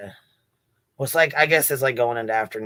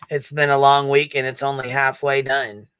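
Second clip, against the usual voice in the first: usual speaking voice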